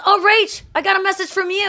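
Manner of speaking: high, bright mock Ross; hammed-up teasing discovery. Ross telling Rachel he found her message, high and teasing. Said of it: "he goes".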